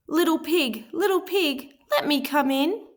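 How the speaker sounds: high, polite pleading wolf voice. A narrator's reading. Wolf politely asks the pig to let him in, high and sweet. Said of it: "Big Bad knocked politely on the door and said".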